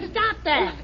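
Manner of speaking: high squealing laugh then stop that; high laughing protest; ticklish laugh. Acted. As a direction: giggle helplessly while tickled.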